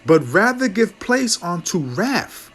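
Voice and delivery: slow, weighty reading of the verse; measured King James cadence; solemn scripture reading. Acted. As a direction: lay each word down like scripture.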